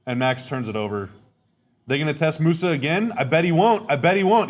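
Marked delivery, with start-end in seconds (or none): 1.9-4.5 excited hype. Real, not acted. Hyping the defender on stream.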